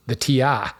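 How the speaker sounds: lightly attempted Maine accent; a hint of backwoods Maine accent, badly faked. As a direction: try a hammy Stephen King-style Maine accent. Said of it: "that's my bad Stephen King inspired Maine accent".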